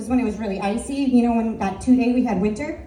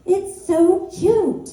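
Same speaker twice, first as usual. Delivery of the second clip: gushing; 7 semitones higher, slower than usual. Retold or imitated. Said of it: "I'm like".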